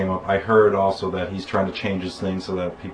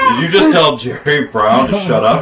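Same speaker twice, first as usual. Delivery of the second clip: loud, higher-pitched incredulous question; mock astonishment; 5 semitones higher, 7 dB louder than usual. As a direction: imitate a stunned host through laughter. Acting out astonished talk show host.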